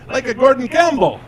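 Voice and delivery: dad proud he understood; Italian dad accent. Retold or imitated. Dad thinking he gets it, high pleased Italian accent. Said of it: "He says".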